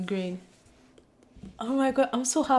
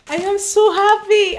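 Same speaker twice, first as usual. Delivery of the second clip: high, loud squeal of joy; delighted shriek at the book she wanted; excited squeal; 10 semitones higher, 10 dB louder than usual. Real, not acted. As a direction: burst out thrilled and loud.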